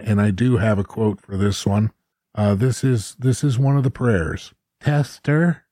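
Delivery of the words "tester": lightly nasal, quavering, higher pleading voice. Retold or imitated. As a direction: read it as a thin, nervous chaplain's prayer, lightly. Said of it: "a nasally voice said over the annunciator".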